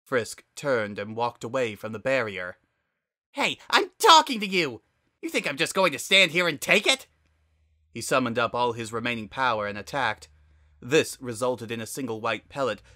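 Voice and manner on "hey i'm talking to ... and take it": high, loud, fast shouting; furious yelling. Acted. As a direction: yell after him as he walks away. Flowey yells after Frisk.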